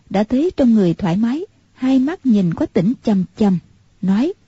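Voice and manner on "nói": plain reading voice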